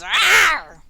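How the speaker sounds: loud angry "Arr"; angry roar. Acted. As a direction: bellow it in anger. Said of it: "kind of, like, anger sound".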